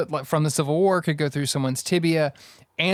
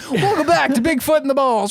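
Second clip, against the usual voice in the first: higher, laughing radio host voice; morning zoo host; 7 semitones higher than usual. Pretend. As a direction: hype it like a morning DJ. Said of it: "That is a morning zoo crew".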